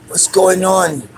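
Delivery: playful put-on Godfather voice; Godfather imitation. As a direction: stretch it like a movie mobster. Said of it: "like, the Godfathers?".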